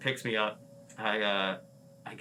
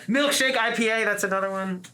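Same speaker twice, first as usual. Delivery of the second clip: excited; 11 semitones higher, 7 dB louder than usual. Retold or imitated. Naming a beer with relish.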